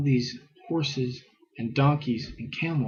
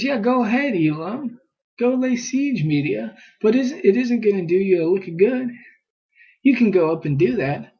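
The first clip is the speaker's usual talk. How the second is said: higher, louder sarcastic jeer; mocking taunt; 8 semitones higher, 5 dB louder than usual. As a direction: taunt them like a scornful God. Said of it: "he mocks them in verse two".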